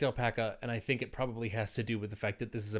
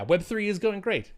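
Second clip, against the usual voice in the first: sarcastic; 9 semitones higher, 4 dB louder than usual. Pretend. Mock cheerful account name.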